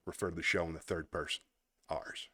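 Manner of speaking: breathy whispered aside. Real, not acted. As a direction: whisper it to yourself. Aside under his breath.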